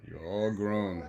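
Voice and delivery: usual speaking voice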